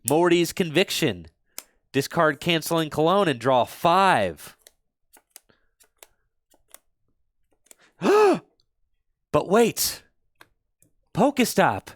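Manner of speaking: hammed-up dramatic reveal, high and rising; overacted gasping surprise; bad acting. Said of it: "Worst acting ever".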